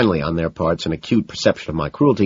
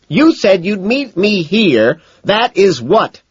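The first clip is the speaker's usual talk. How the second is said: high, loud, slow scolding; angry accusation; 7 dB louder, much slower than usual. A narrator's reading. Evelyn reminds him of their meeting, angry accusation. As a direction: hammer each word at him.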